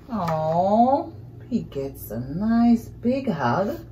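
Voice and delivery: plain reading voice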